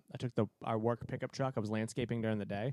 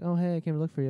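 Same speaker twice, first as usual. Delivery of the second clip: imitated girl, higher and louder; perky greeting; 6 semitones higher, 6 dB louder, faster than usual. Girl greeting the man she came to look for, perky. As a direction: perk up in a mock friendly hello. Voices girl's reply on seeing him.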